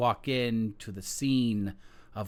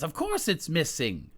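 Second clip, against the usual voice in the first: haughty queen; 13 semitones higher than usual. Acted. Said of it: "And the queen says".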